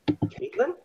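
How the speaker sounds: high, soft, faint questioning call of a name; a weak man unsure who he hears; softly voiced confused captive; dazed recognition. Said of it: "and then he says".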